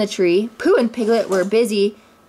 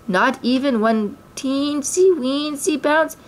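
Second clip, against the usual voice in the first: pleading; 6 semitones higher, slower than usual. A narrator's reading. Tigger pleading sadly.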